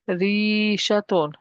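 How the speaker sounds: slow rishatun with held "rii"; long I vowel. Shown as a demonstration. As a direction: stretch the first vowel of rishatun. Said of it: "Kasra with the ya before it".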